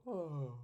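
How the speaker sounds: a short demonstrated yawn; mock yawn. Shown as a demonstration. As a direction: pretend to yawn mid-sentence. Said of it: "you make a noise like you're".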